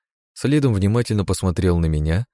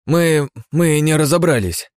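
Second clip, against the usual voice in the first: slow, stammering and guilty; guilty sons; 9 semitones higher, 4 dB louder, slower than usual. Acted. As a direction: stammer an apology. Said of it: "виновато проговорили парни".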